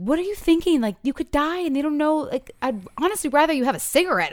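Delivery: high, alarmed parental outburst; alarmed angry parent. Retold or imitated. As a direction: snap at the teen in shock. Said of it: "my first thought would be like".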